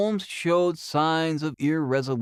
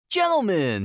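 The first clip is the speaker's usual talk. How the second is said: a ringing call, louder; cried out; 5 semitones higher, 4 dB louder than usual. A narrator's reading. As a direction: announce it in triumph to the room. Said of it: "he cried".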